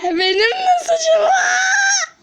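high drawn-out cry of protest; wailing shout. Acted. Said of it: "Benim ne suçum var be?".

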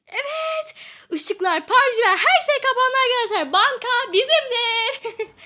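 loud, high, laughing cheer; triumphant excitement. Real, not acted. Bank shuts down and she claims it, loud laughing cheer. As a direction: crow with glee as the bank is ours.

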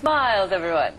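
loud, grand TV host catchphrase; playful loud impression of a TV host; Ricardo Montalban. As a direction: call it out like a show's welcoming host. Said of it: "And Ricardo Montalban".

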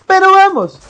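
high, loud burst of hype; loud, high-pitched battle cry; rallying cry. Real, not acted. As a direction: call it out high and punchy, falling at the end.

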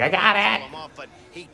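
put-on high, slow drawn-out kids' "I got it!"; mock kid's call. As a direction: imitate young players calling the ball. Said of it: "everyone's like".

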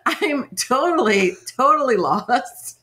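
ordinary talk, nothing marked

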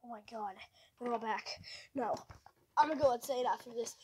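ordinary talk

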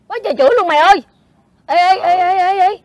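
high, loud, urgent yelps; alarmed outcry. Acted. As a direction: call out in mounting alarm to a friend. Alarmed by insulting comments online. (giọng cao, to, hốt hoảng)

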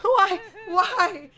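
high terrified yelling, breaking into laughing sobs; scream, then laugh-cry out of fright; scared scream. Real, not acted. Streamer jump-scared by a horror game, shrieking then laugh-crying.

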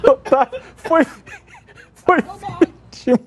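breaking into laughter mid-sentence; words squeezed out through giggles; laughing. Real, not acted. Laughing at colleague's mosquito mishap.

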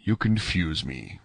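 low, slow and courteous; understated gentleman's reply, softly spoken; gentlemanly air. A narrator's reading. Athos modestly answering thanks, gentlemanly. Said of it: "with his gentlemanly air".

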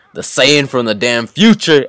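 usual speaking voice

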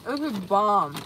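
talking through a mouthful; chewing while talking; mouth full. Real, not acted. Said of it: "Sorry for talking with my mouth full".

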